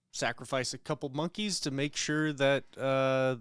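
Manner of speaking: ordinary talk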